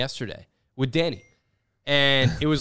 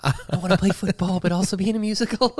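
high, hammed-up tremulous confession; mock quivering voice; 6 semitones higher than usual. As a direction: wobble through a joke voice note. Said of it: "the quiver in their voice".

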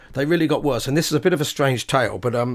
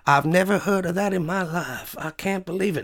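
a hint of an elderly lady in disbelief, higher; lightly voiced incredulous old lady; 5 semitones higher than usual. Receptionist tells the band she cannot believe it, mildly voiced old lady. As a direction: gasp it out in mild disbelief. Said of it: "she was incredulous".

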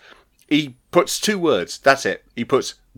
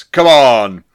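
emphatic call; 9 dB louder than usual. Retold or imitated. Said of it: "He says".

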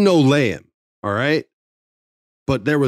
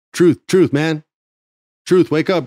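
raised, higher wake-up call; calling to wake someone; 5 semitones higher, 5 dB louder than usual. Retold or imitated. Writer to sleeping wrestler in dark dressing room, calling out to wake him.

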